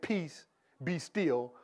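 slow command. Retold or imitated.

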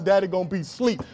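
ordinary talk, nothing marked

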